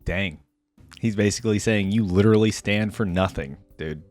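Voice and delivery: ordinary talk, nothing marked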